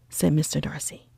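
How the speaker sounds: plain reading voice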